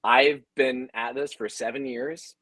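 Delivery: ordinary talk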